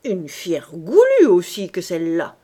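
loud, grudging exclamation; grumbling scold. A narrator's reading. Ursus scolds the greedy baby after coughing, grumbling. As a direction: remark on it in gruff exasperation. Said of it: "gronda Ursus".

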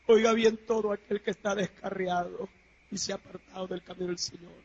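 usual speaking voice

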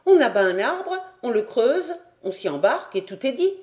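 put-on high, eager boyish enthusiasm; carefree confidence. A narrator's reading. Boy who doubts nothing explains his simple plan, eager and confident. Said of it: "qui ne doutait de rien".

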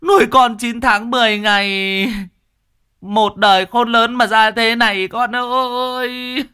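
high, keening funeral lament; wailing mourner. A narrator's reading. Grieving parent wails over a dead son at the funeral, high keening lament. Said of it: "những tiếng khóc thấu trời".

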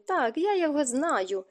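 ordinary delivery

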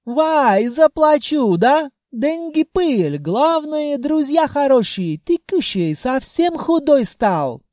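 put-on high, slow, generous Caucasian accent. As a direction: cheerfully shrug it off, accented. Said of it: "настроение Джина опять сменилось".

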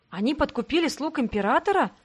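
ordinary delivery, nothing marked

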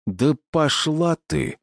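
slow, high snarl through clenched teeth; through gritted teeth. A narrator's reading. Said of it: "процедил я сквозь зубы".

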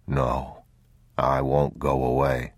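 low, slow, soft reassurance; calm a frightened child; soothing father. Acted.

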